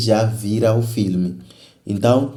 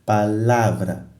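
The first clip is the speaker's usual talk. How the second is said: slow "Palavra", leaning on LA; slow teaching demo, pa-LA-vra; stress on the middle syllable; slower than usual. Shown as a demonstration. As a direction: draw out "Palavra" to show the stressed LA. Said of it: "é aí onde tu gastas mais energia".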